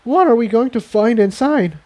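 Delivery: high, timid pony voice; high nervous filly. Acted. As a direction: ask it with worry in a small high voice. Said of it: "Tiptoe asked nervously".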